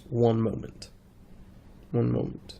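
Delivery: blocked nose before blowing it; stuffy nose. Real, not acted. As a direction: talk through a stuffy nose. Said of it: "Sorry to blow my nose".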